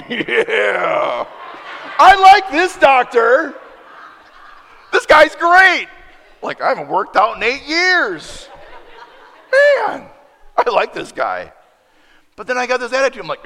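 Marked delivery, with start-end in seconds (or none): 0.0-11.5 loud laughing, higher and louder; real laughter through the words; laughing delight. Real, not acted. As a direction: laugh it off, flattered. Laughing at the compliment.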